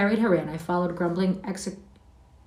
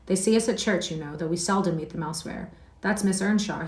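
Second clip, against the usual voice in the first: usual speaking voice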